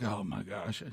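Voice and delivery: low, soft aside; mock dismay. Host realizes who the guest is, quiet mock dismay. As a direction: drop to a dismayed undertone. Retold dismayed host's muttered reaction.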